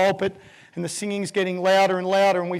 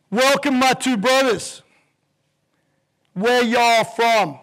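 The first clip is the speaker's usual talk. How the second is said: loud, slow drawn-out welcome; a Southern reverend greeting two strangers; warm Southern preacher; 3 semitones higher, 5 dB louder, slower than usual. Retold or imitated. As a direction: pastor welcoming the Australian visitors.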